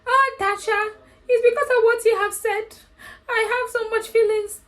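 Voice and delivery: high, sappy mock lover's voice; mocking Andrew's love letter to Tasha, high and syrupy. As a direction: gush it out in mockery. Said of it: "it was a love letter to Tasha".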